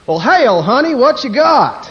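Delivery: loud, high hammed-up Texas drawl; Texan accent. Texan answering stewardess, loud mock Texas drawl. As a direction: holler it like a caricature Texan. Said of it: "you get to the Texan".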